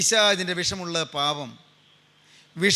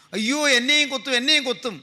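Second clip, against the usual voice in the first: mildly acted frightened child's cry; imitated wailing child; 5 semitones higher than usual. Younger brother fears he will be stung, mock wail. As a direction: mimic a scared little boy wailing, without full force. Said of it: "കുട്ടി ഇങ്ങനെ ഉച്ചത്തിൽ കരയുകയാണ്".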